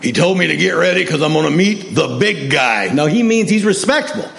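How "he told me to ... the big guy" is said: imitated friend's plainspoken voice, halting phrases; Neil Mansfield. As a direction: retell his news in his voice, with pauses. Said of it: "That's just the way Neely Joe talked".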